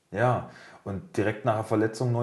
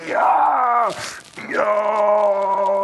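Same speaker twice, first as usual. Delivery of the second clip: high, loud jubilant shout; re-enacted burst of joy; overjoyed cheer; 11 semitones higher, 7 dB louder than usual. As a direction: yell it out like you just won the prize. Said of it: "Alter, ich hab mich so gefreut".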